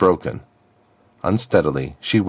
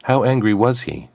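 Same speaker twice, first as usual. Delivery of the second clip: ordinary delivery, nothing marked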